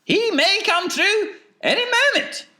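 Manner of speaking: very high, loud falsetto; piping eccentric announcement; expectant Wonka. A narrator's reading. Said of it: "said Mister Wonka".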